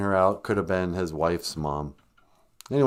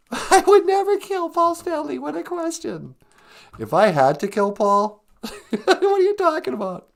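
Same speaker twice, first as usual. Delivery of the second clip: much higher, laughing shocked puppet; 4 dB louder than usual. Acted. Puppet laughing off the host's kill question, high. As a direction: recoil in the puppet's squeaky voice.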